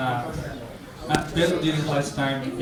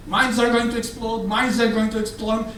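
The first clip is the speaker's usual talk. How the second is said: mock screaming; 7 semitones higher than usual. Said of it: "keeping screaming".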